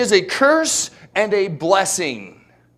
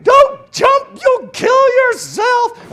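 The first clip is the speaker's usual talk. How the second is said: shrill panicked scream, much higher and louder; a woman's frantic warning scream; 10 dB louder, much slower than usual. Retold or imitated. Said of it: "This lady's screaming".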